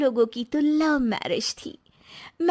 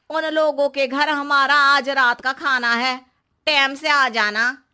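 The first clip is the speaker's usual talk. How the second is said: ordinary talk, nothing marked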